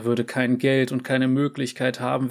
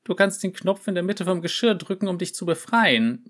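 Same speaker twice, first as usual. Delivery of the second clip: higher mewing voice; a hint of a cat mewing; meowing; 6 semitones higher than usual. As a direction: mew it hopefully. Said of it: "miaute er".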